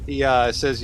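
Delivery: usual speaking voice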